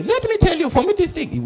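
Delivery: high-pitched butting in; eager interruption. Acted. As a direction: barge in high over the interviewer. Witness butts in over the reporter.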